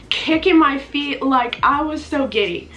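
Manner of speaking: usual speaking voice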